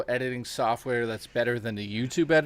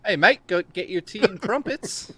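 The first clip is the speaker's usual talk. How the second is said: put-on British accent; 6 semitones higher than usual. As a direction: ham up a bad British accent. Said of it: "Can you do a British accent?".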